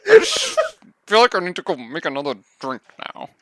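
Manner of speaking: very high, fast whiny cartoon teen; Lumpy Space Princess voice. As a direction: squeal it like a spoiled cartoon princess. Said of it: "that's a really good impression".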